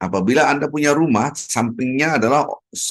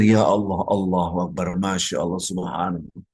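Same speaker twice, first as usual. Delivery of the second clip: quiet, lower devotional praise; softly murmured dhikr; 7 semitones lower, 5 dB quieter than usual. Retold or imitated. Preacher shows congregation dhikr while watching rain, softly. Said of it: "melihat hujan dengan berzikir".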